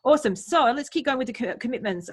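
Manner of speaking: ordinary talk, nothing marked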